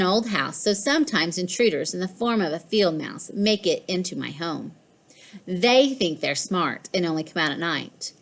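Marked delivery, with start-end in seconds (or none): none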